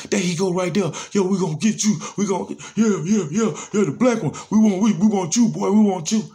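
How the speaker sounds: high, loud jeering yell; mock inmates taunting. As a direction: holler like inmates taunting the new guy. Inmates taunting through door, retold.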